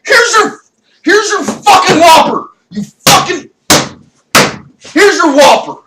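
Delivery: high, loud manic yelling; furious outburst. Retold or imitated. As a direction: scream it at the customer. Acted outburst at Burger King.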